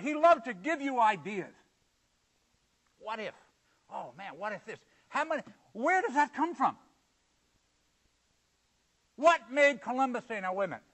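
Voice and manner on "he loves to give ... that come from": normal talking voice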